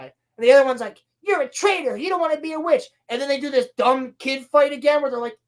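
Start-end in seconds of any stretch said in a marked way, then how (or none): 1.2-2.8 high-pitched mock kid squabble; mock childish accusation. One sister calls the other traitor, mock accusing. Retold kids' quarrel, accusing.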